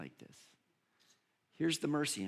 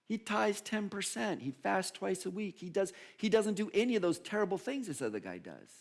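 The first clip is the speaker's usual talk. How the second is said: higher-pitched mock protest; mock defence; 8 semitones higher than usual. Preacher sticking up for the poor Pharisee, mock-indignant. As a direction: plead the case in a high put-on voice.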